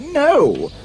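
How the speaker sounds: high, horrified cry; screamed. A narrator's reading. Prince rejects the ugly sister, screaming. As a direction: cry out in refusal, pitch up high. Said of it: "The prince screamed".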